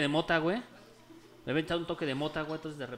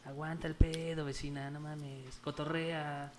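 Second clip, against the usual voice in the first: slow, much softer lazy reply; stoned drawl; 4 semitones lower, 9 dB quieter, much slower than usual. Retold or imitated. (vecino drogado, lento y muy suave)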